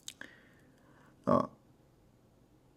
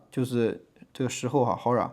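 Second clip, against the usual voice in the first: ordinary delivery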